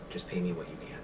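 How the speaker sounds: low, very soft aside; hushed concession. Acted. Shopkeeper yielding to a customer while masters watch, hushed and low. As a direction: keep it down, as if the masters might hear.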